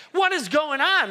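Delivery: put-on older son confused by the noise, surprised call; puzzled outburst. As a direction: react like you just heard a party. Older son hears the party.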